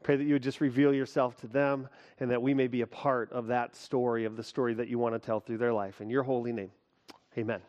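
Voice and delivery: hushed, soft prayer voice. Acted. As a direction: pray it low and earnest. Closing prayer before sermon.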